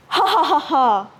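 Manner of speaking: a booming villain's laugh; mocking serpent laugh. Serpent mocks Lagaligo with a scornful laugh. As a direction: laugh at the little prince.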